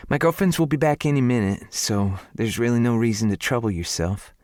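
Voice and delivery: a Southern lady turning down help; lightly voiced southern woman. Her lines in a higher voice.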